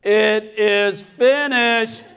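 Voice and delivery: high, slow, drawn-out call. Acted. Stage Jesus cueing a late soldier, calling out high. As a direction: call out the missed cue, stretching every word. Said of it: "Jesus raises his head again. He goes".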